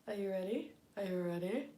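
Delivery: ordinary delivery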